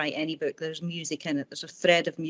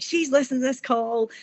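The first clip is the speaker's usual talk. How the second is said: higher, brighter burst of delight; half-laughing disbelief, pitched up; mock excited exclaim; 8 semitones higher, 3 dB louder than usual. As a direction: blurt it out amazed that she can hear. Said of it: "and I was like".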